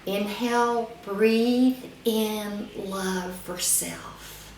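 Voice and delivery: very slow, stretched words with long breathing pauses; guided breathing mantra. Shown as a demonstration. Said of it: "Think to yourself or say out loud".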